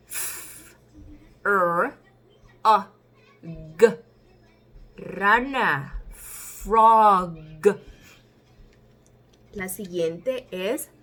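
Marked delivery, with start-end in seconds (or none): 0.1-4.0 "F, R, A, G" as separate sounds, F just blown air; sounded-out letters. Shown as a demonstration. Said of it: "echan el aire". 6.1-7.4 "frog" loud and drawn out, long f; English word demo. Shown as a demonstration. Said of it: "es en inglés".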